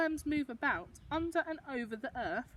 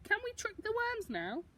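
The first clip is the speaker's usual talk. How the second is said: put-on eager child; 10 semitones higher than usual. Child narrator asks Gran.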